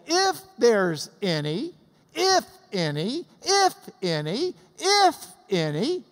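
slow, rhythmic punch on IF and ANY; insistent repeated IF. Retold or imitated. Teacher as Paul pressing the point, insistent IF ANY. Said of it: "Paul is saying".

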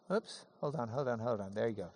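usual speaking voice

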